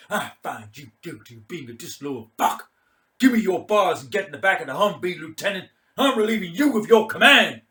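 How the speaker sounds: loud, high, fast sentencing; drunken mock judge. Mad Mike relieving the lieutenant of command, loud contemptuous verdict.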